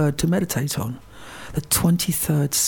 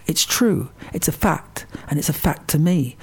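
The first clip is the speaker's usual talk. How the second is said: normal talking voice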